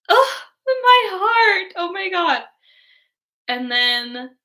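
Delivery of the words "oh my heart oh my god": much higher, louder, slow, half-crying wail; overwhelmed cry. Retold or imitated. Fan reacting to a heartbreaking movie moment, crying out high and loud. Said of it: "and I'm like".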